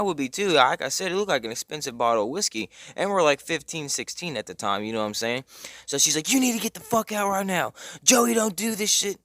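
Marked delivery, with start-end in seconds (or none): 6.3-9.2 high, strained retold yell; mock screaming mom. Mom throwing out her son's friend, mock screaming. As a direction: imitate a mother yelling at her son. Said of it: "So she's screaming at him".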